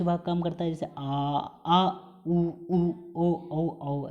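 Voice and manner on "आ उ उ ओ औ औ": "आ, उ, उ, ओ, औ, औ" each said deliberately; back vowel drill. Shown as a demonstration. Teacher modelling back vowels for students to repeat. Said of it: "ऐसे आप बोल सक-, बोल के दे सकते हैं".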